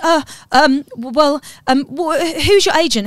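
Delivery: high-pitched stammering, imitating someone caught out; mock flustered. Producer put on the spot by an angry poet, mock flustered.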